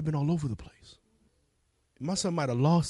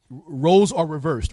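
usual speaking voice

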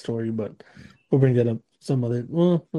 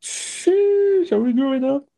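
mock astonished; 12 semitones higher, slower than usual. Teasing exclamation.

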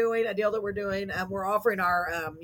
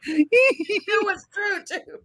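laughing; 10 semitones higher, much slower than usual. Real, not acted. Laughing at her own joke.

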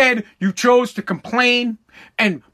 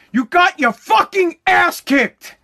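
slow angry shout; 7 semitones higher, slower than usual. Real, not acted. Ranting at Trump's team, slow furious shout. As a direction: yell it out word by word.

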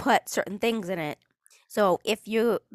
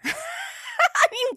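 high, squealing laugh into the words. Real, not acted. Host cracking up at a silly prayer tweet, real laughter. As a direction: break up laughing.

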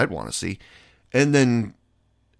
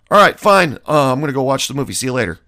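louder, higher, then rushed fast; mock huffy brush-off; 4 semitones higher, 6 dB louder, much faster than usual. Friend leaving for the movie alone, huffy and rushed. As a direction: toss it off like you don't care, rattling through.

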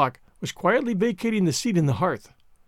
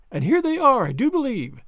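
higher, bright, eager exclamation; a burst of eager excitement; excited; 6 semitones higher than usual. A narrator's reading. Sally hears the horses arriving, excited.

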